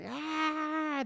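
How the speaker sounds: long, high-pitched squeal; drawn-out high "aah" like rowdy kids; mock kid squeal. Storyteller imitating kids zigzagging through a subway car, mock squeal.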